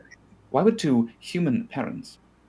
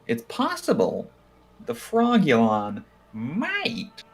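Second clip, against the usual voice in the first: slow, drawn-out, higher musing; slow drawn-out speculation; 6 semitones higher, much slower than usual. Real, not acted. Speculating about the Frogulon's powers, slow drawn-out musing. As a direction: stretch each word while pondering.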